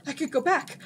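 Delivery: the idea dawns with joy; excited realization. A narrator's reading. Jin realizes escape.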